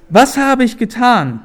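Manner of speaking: high-pitched cry of protest; calling out. A narrator's reading. David demands to know his crime, calling out. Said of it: "rief er".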